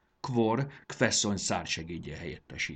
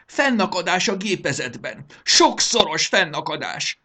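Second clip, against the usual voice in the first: high, loud ranting fury; theatrical raging outburst; 11 semitones higher, 10 dB louder than usual. Acted. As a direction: storm at full voice, pitched high. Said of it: "dühöngött".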